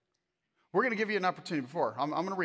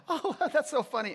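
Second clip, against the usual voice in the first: high-pitched imitation of a laughing listener; hammed-up laugh running into the words; mock amusement; 10 semitones higher than usual.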